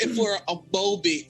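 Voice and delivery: usual speaking voice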